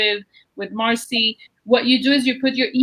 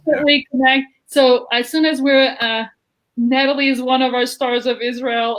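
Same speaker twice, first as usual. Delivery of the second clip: ordinary talk, nothing marked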